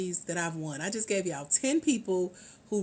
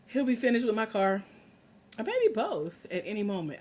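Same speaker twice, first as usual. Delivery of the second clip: usual speaking voice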